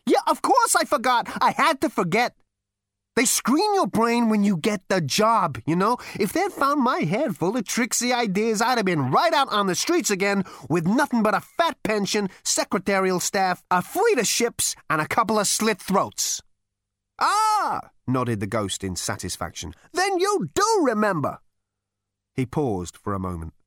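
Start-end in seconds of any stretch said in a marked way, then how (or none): 0.1-16.4 lightly raised brash voice; a hint of a brash galactic president; Zaphod's voice. Zaphod explains. 17.2-21.4 put-on high, scornful old man; ghostly great-grandfather scolding; crotchety old ghost. Ghost satisfied.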